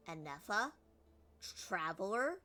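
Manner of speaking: Enefa and Traveler each rising up at the end; shocked rising question. Shown as a demonstration. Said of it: "emphasis at the end a little bit higher".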